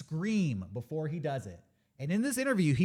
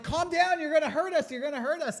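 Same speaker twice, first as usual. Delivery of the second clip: higher, louder urgent pleas to calm down; stunt guys pleading; 10 semitones higher, 3 dB louder than usual. Retold or imitated. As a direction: hold back a wild man and beg him to stop. Stunt guys trying to grab him.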